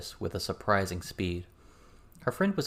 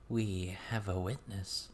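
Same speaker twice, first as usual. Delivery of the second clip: slow, knowing voice; a hint of a sly guide; lightly voiced mysterious woman; 7 dB quieter, slower than usual. A narrator's reading. Miss turning to the young practitioners, slow and knowing. Miss's voice.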